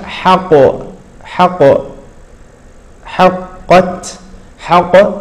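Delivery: حقت with the ق bounced before the ت; wrong qalqala demo. Shown as a demonstration. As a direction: show the mistake of echoing ق in حقت. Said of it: "فما هكذا كانت العرب تنطق". (قلقلة خاطئة للقاف في حقت)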